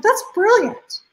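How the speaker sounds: slow, high and amazed; a lit-up, wide-eyed that's brilliant; delighted. Retold or imitated. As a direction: savour the discovery, drawn out and high. Delighted reaction to a tip.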